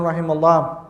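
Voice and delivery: ordinary talk, nothing marked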